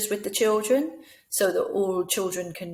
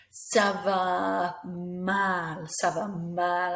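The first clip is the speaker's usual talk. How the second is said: slow, dragging misery; acted feeling bad; much slower than usual. Shown as a demonstration. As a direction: drag it out as if feeling rotten.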